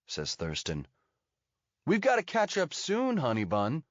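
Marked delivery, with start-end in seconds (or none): 1.8-3.8 high, taunting American voice; mock-sweet American, pitched up; American accent. A narrator's reading. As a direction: call after her in an American accent.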